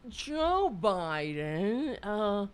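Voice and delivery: very slow, slurred mock rant; imitating drunken Don Jr. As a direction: drag it out like a drunk ranting. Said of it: "His drunken, well, high".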